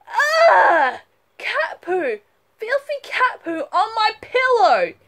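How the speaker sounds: high, loud disgusted scream. Acted. Girl finding poo on her pillow, screaming. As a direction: shriek at the mess on the pillow. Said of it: "and screams".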